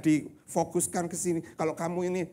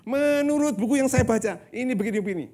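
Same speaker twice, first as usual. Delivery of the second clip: loud, high-pitched mock lecturer; 7 semitones higher, 11 dB louder than usual. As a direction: parody a lecturer insistently quoting his books. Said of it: "Ada seorang dosen".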